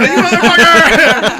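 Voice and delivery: high, loud drawn-out cry of betrayal; outraged mock shriek. High friend shouting at the one who skipped his dose, outraged. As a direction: shriek it like you've just been betrayed. Retold outburst at friend's confession.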